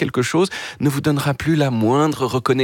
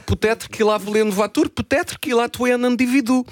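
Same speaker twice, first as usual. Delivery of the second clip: put-on French with a Portuguese accent, pitched up; 9 semitones higher than usual. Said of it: "je parle français avec l'accent portugais".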